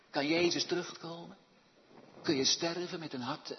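usual speaking voice

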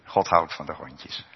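usual speaking voice

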